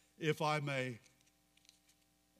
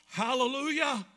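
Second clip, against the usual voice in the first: loud, high jubilant shout; crying out; 10 semitones higher, 7 dB louder than usual. Acted. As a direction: roar praise like a vast crowd. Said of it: "crying out".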